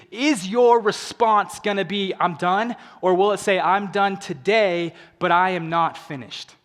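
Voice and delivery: rally the crowd with rising force; fired-up keynote delivery; motivational preaching. Acted. Motivational climax.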